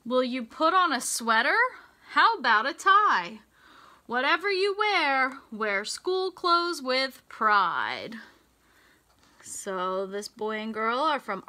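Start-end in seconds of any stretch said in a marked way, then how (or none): 0.0-8.2 bright, sing-song storybook read; lilting picture-book voice; reading to children. Acted. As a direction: read the rhyme to a class of little kids. Reads rhyming picture book aloud.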